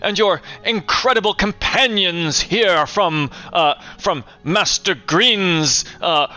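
high, eager welcoming mayor; hammy small-town mayor gushing; eager town official. Acted. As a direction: greet the heroes with effusive pomp. GM voicing mayor.

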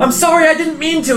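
put-on high-pitched kid begging forgiveness; acted, high apologetic plea; pleading apology. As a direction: plead as the kid just thrown out. Thrown-out kid pleads with man.

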